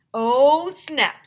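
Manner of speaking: high, loud teasing cheer; high, loud schoolyard taunt; delighted oh snap. Acted. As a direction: crow over the burn. Kid reacts to insult.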